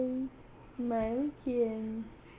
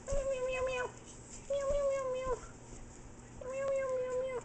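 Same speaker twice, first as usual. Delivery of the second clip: high, sing-song kitten meows; high-pitched pretend cat; kitty meows; 13 semitones higher, 6 dB quieter, faster than usual. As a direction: play a little kitten mewing, very high. Imitates a kitty for baby.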